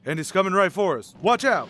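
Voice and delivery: loud urgent warning, rising to a yell; warning shout. Acted. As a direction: shout to your partner to dodge the car. Partner sees suspect car charging.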